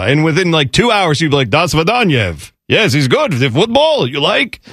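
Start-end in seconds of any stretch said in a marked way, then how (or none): 1.5-4.6 hammed-up Russian English; mock Russian accent. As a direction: put on a comic Russian accent. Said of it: "put him in Russia".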